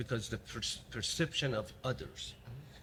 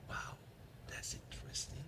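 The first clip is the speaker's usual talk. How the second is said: retold reaction, barely voiced; quiet, breath-only musing; hushed amazement; 4 semitones higher, 11 dB quieter than usual. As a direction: drop to a near-whisper, taking it in. Retold stunned reaction to colleague's remark.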